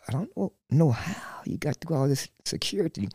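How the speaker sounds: hushed, threatening officer whisper. Retold or imitated. Officer leaning in to the arrested fan, whispered.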